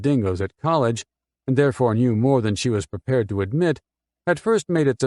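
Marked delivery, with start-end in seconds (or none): none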